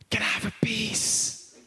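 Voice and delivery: put-on sneaky child's whisper, drawn out. Daughter begging dad behind mom's back, whispering. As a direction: whisper it slowly like a secret request. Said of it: "why are you whispering".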